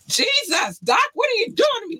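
high, fast playful shout; mock outrage. Real, not acted. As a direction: cry out in mock despair. Playful protest at hard quiz.

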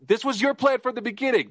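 high-pitched mock accusation; mock meme quote. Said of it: "And it's like".

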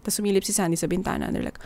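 ordinary talk, nothing marked

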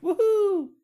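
a high ironic whoop of fake joy; mock cheer. Mocking his own new work phone, high sarcastic whoop. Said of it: "So I'm kind of important".

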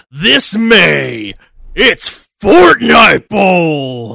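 loud, slow shouted hype; monster truck announcer. Acted. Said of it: "legal monster truck announcer".

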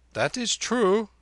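put-on high, loud boyish assertion; high-pitched emphatic youngster; boy Abdullah. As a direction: confirm it loudly in a high boy's voice. Narrator voices Abdullah.

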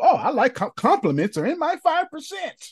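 very high, gushing squeal of pleasure; hammed-up high-voiced glee; mock delight. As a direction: play up being flattered in a high-pitched voice. Mimics own delight at compliments.

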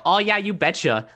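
tossing off a folksy Minnesotan "you betcha"; Minnesotan accent. Retold or imitated. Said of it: "being a born and raised Minnesotan".

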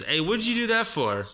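"what'd you" run together, pitched high; high, casual "whudja do that for"; blended native talk. Shown as a demonstration. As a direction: call out "what'd you do that for" with "did" swallowed. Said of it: "it's just blended in".

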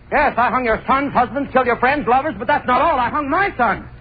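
high frenzy breaking into laughter; hysterical confession. Acted. Hangman confessing to the mob outside, hysterical. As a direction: cry it out wildly, climbing, then crack up laughing.

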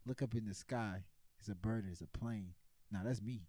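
understated mock smooth talker; pretend pickup line. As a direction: play it cool and low. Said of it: "I'm gonna say, "Yo, use the line".

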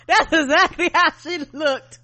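high, loud and slow through laughter; real laughing. Real, not acted. Co-host cracking up at how she looked, laughing. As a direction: crack up while agreeing.

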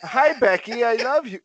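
high-pitched, sing-out declaration of love; sweet placating call. Real, not acted. Caught out by partner in the room, high placating call. Greets partner overhearing the joke.

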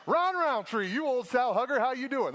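imitating dad hailing a friend with his cuss word; hearty dad greeting. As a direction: boom a cheery greeting at an old buddy.

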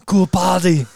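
a hammed-up Arnold quip; Arnold impression. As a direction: punch out the action-star one-liner. Repeats Arnold line.

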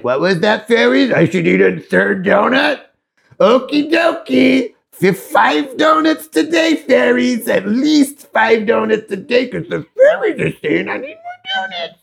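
high, silly squeal of fake innocence; mock gleeful self-deception. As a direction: play it high and giddy, building to a squeal. Acting out fooling yourself about donuts.

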